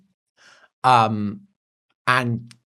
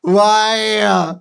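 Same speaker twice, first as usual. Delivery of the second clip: frustrated cry; 7 semitones higher, 5 dB louder than usual. Retold or imitated. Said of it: "And I'm like".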